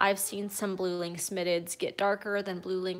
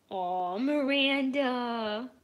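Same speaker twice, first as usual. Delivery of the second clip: 1 cooing; 4 semitones higher, much slower than usual. Real, not acted. Cooing at cat.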